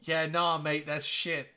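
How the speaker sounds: lightly put-on Aussie accent; faux Australian accent. Friend judging his fake accent, faux Australian accent. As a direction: try a rough Australian accent. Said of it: "in a faux Australian accent".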